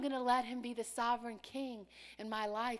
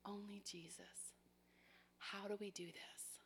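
soft unvoiced hushed words; reverent whisper; 13 dB quieter than usual. Real, not acted. Preacher confiding to congregation in a reverent whisper. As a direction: share it in a hush.

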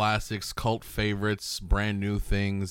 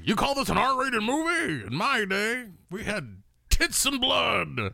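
put-on grumpy old horror fan; 14 semitones higher than usual. Cohost playing an angry old-school horror fan, high crotchety shout. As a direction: shout like a cranky old man shaking his fist. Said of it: "not horrific enough for him".

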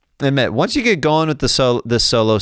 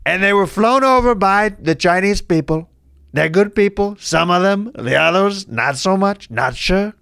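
higher, emphatic caricature of a boastful politician; blustering politician impression; 8 semitones higher than usual. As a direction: lay down the facts in a put-on politician voice.

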